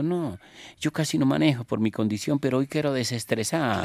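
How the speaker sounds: put-on high, loud Argentine-accented pope. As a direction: play the pope pleading in an Argentine lilt. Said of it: "así con el acento argentino".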